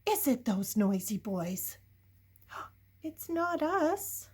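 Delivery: ordinary delivery, nothing marked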